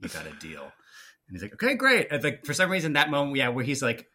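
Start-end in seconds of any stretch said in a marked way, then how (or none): 0.0-0.6 low, soft, calm acceptance. Retold or imitated. Fan acting the deal being accepted, calmly and low. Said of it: "him just calmly being like". 1.5-2.1 high, loud, freaked-out agreement; high-strung. Retold or imitated. Fan mimicking the hero's reply, high strung and freaking out. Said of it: "he's just high strung and freaking out".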